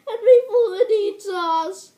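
loud, fearful wail; frightened cry. Acted. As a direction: plead in fear. Fear of being eaten.

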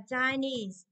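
"Chinese" drawn out, stressed on NESE; stress on last syllable. Shown as a demonstration. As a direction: slowly stretch "Chinese", leaning on its ending. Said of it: "trọng âm cũng rơi vào ni".

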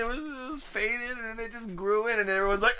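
usual speaking voice

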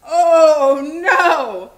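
very loud, high wail of dismay; big, drawn-out alarm; alarmed cry. A narrator's reading. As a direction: cry out in storybook horror. Alarm at avalanche in story.

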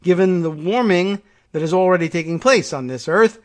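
reading a leader's speech aloud with a measured, declaiming tone; formal speech read. Acted. Reading the prime minister's climate speech to viewers, formal oratory. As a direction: deliver it like an address to world leaders.

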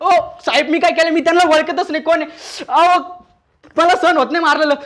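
high, loud protests under a beating; re-enacted yelping plea to the policeman; pained pleading. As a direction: plead loudly as blows land, pitch way up. Acts out pleading while being beaten.

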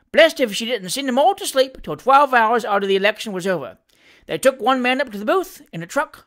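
lively dialect servant reporting with relish; Sam Weller, cheeky manservant. Acted. Valet telling his master of the election, animated Cockney.

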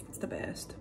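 soft whispered aside; whispering. Real, not acted. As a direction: breathe it out in a whisper.

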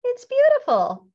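high, loud delighted gush; delighted admiration. A narrator's reading. Mom admiring what Benny bought, loudly delighted.

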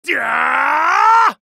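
high, loud cry of defeat; pained shout; anguished yell. Acted. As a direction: scream as you are beaten.

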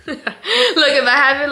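laughing while talking. Real, not acted. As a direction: giggle through the words. Laughing at height difference.

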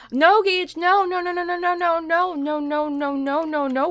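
high, loud string of no's, easing off; urgent high yelp, gradually coming down; alarmed scolding. Real, not acted. Stops game toddler painting the floor.

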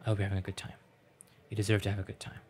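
ordinary delivery

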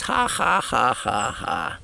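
high ha-ha-ha falling away; mock laugh. Dreamer with his friend as cops burst in, mock laugh. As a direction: laugh it out high, then let it sink.

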